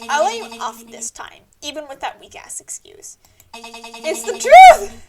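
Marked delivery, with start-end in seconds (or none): 3.5-5.1 loud, slow, higher protest; indignant shout. Acted. Streamer defending the lie to the game: loud drawn-out protest. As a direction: shout it out as an outraged denial.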